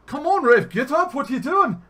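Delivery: high, loud, hammed-up Irish accent. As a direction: egg on a friend in a shouted mock-Irish voice.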